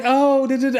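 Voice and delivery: high mock babble of Julia. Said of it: "she's just like".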